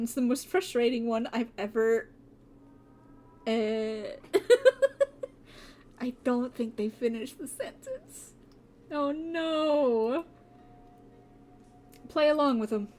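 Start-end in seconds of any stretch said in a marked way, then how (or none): 3.5-5.2 laugh. Real, not acted. Gamer laughs at cut line. 8.9-10.2 mock dismay. Real, not acted. Gamer reacts to choice.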